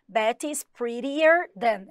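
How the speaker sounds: English read slowly and clearly for learners; slow model sentence. Shown as a demonstration. Teacher to students, slow model reading. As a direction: spell it out word by word, like a teacher. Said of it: "Então, ó".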